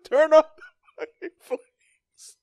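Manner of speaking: slow, high, laugh-choked squeak; laughing high mock voice. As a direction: wheeze it out in a silly voice. Mock quote while laughing.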